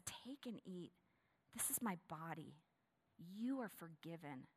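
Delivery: soft, hushed words of Jesus; softly voiced Jesus at supper; tender. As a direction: offer it gently.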